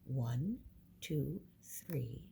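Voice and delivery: ordinary talk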